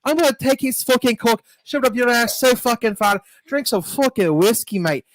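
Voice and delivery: high, hammed-up British swearing; a high mock Brit ranting at a mate; British cursing. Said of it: "Can you just do a line of cursing?".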